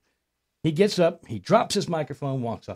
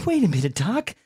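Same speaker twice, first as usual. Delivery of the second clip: high mock female voice, dismayed; caricatured working girl balking at a price; imitated girlfriend; 12 semitones higher than usual. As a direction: protest in a pitched-up girlfriend voice. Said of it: "She says".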